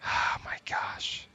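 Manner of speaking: slow, whispered oh my gosh; weary whisper. Real, not acted. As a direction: breathe it out, worn out. Weary after daughter's lateness.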